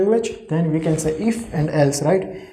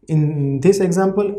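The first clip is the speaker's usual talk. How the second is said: normal talking voice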